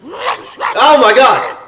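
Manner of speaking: high, drawn-out panicked yell; real shriek of shock; startled scream. Real, not acted. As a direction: scream it out in sudden fright.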